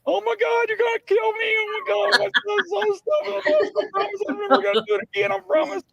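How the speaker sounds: very high, shrieking mock panic; terrified pleading. Panhandler begging the officer not to kill him, shrieking. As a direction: squeal it like you think you're about to die. Panhandler thinks he'll be killed.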